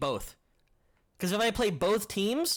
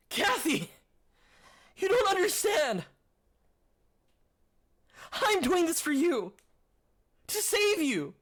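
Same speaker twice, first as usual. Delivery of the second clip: hammed-up anguished cry; 10 semitones higher than usual. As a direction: overact the tears, yell it up into the rafters. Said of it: "that's not a good Deku".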